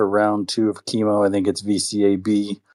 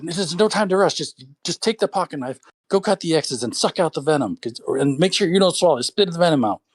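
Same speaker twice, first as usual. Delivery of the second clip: much higher, urgent instructions; hurried grandpa; 8 semitones higher than usual. Retold or imitated. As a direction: rush the orders out. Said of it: "He said".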